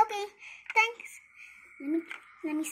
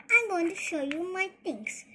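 usual speaking voice

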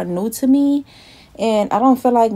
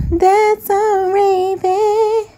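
raven croak; 11 semitones higher, much slower than usual. Pretend. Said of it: "That's me".

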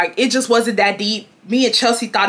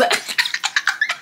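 soft, voiceless giggling fit; imitated laughing; 5 dB quieter than usual. Showing how she and her friend laughed together, imitated laughing. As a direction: wheeze out a childish silent laugh. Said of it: "going like this".

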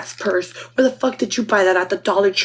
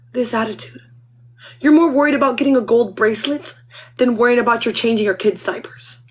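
ordinary delivery

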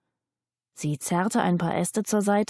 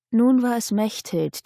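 plain reading voice

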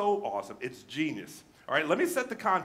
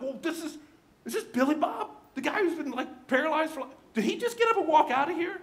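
high, disbelieving exclamation; astonished onlooker; 10 semitones higher than usual. Pretend. Townspeople seeing paralyzed man walk, astonished. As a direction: gasp out the disbelief with a laugh. Astonished crowd voiced in story.

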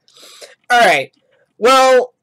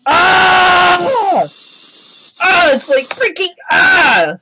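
pained screaming; 10 semitones higher, much slower than usual. Real, not acted. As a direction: scream as if your ears hurt. Screaming in pain at awful noise.

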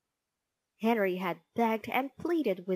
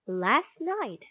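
plain reading voice

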